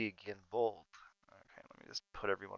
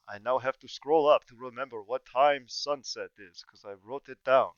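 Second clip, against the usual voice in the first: loud, slow spooky host welcome; hammy horror host with cackles; 2 semitones higher, 13 dB louder than usual. Acted. GM opens session as horror host.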